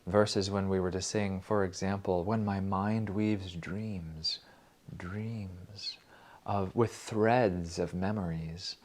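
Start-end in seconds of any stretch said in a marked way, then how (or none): 2.2-8.8 slow, dreamy reading; poetic recitation. Acted. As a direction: let the words drift slowly. Reading the verse slowly.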